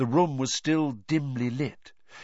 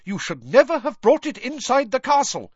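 high, loud reprimand; McGonagall's angry yell; 6 dB louder than usual. A narrator's reading. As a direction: yell it in outrage, pitched way up. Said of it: "yelled Professor McGonagall".

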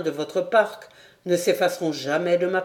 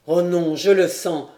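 ordinary delivery, nothing marked

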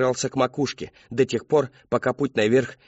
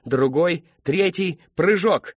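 rising, louder with each step; building excitement; 6 semitones higher, 4 dB louder, slower than usual. A narrator's reading. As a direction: build up to the leap.

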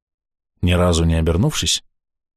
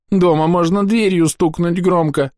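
put-on high, slow tearful little girl; sniffling child; 11 semitones higher, 4 dB louder, slower than usual. A narrator's reading. Girl sniffles her answer to the priest, high and tearful. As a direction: sniffle through it. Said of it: "хлюпает носом девочка".

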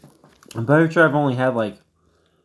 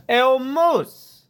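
high, goofy call into the woods; calling the moose in a squeaky falsetto; 11 semitones higher, much slower than usual. Acted. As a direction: holler for the moose in a high silly voice.

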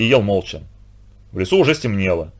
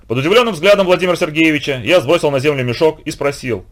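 usual speaking voice

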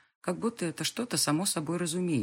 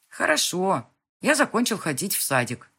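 put-on loud, high, boastful little boy; proud child voice; 3 semitones higher, 8 dB louder than usual. A narrator's reading. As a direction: say it like a proud kid. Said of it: "произносит с гордостью".

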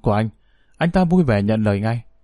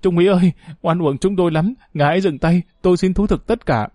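wailing cry; 5 semitones higher than usual. A narrator's reading. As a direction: beg at the top of your voice. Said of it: "một tên gào lớn".

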